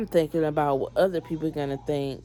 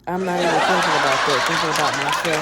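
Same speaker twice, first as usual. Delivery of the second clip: talking through a real laugh, louder; 6 dB louder, much faster than usual. Real, not acted. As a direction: laugh at your own foolishness while talking. Laughs at own foolish refusal.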